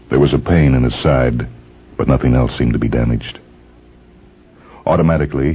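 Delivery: normal talking voice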